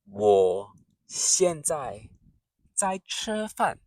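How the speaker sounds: 我现在在吃饭 said slowly, 现 short and sharp; slow careful model. Shown as a demonstration. Teacher drills the sentence for learners, slow and clear. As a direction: space out each syllable. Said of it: "Keep your voice short and sharp on 现".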